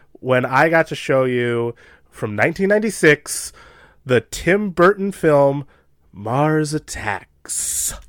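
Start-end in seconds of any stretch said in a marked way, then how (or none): 6.1-8.0 slow, drawn-out movie title; dramatic title reveal. Acted. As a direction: announce it like a trailer. Announcing the film title dramatically.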